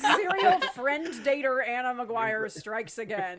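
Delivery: slow, laughing mock announcement; mock announcer. Teasing the old friend with a slow, laughing mock headline.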